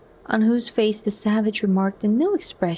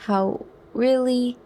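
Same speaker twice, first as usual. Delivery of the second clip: higher, slow, voice wavering; unsteady voice; 5 semitones higher, slower than usual. A narrator's reading. Bernard apologising to John, voice unsteady. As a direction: let the apology catch in his throat. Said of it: "the unsteadiness of his voice".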